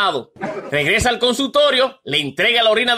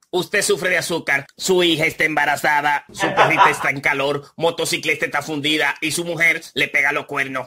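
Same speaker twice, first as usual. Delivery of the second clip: much lower computer readout; voix de robot; 9 semitones lower than usual. Acted. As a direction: announce the analysis like a machine, low. Computadora analiza la mezcla.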